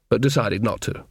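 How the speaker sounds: ordinary delivery, nothing marked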